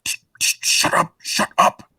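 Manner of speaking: breathy hushing whisper, no voice; quieting him fast before it goes wrong; urgent whisper. Acted. Hushing partner at gunpoint.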